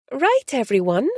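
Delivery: sing-song voice. A narrator's reading. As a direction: lilt it like calling to a crowd. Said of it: "she said in a sing-song voice".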